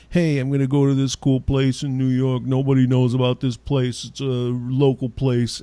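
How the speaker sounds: hint of a New York insider; mild New York voice; New York accent. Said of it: "talking in kind of that kind of voice".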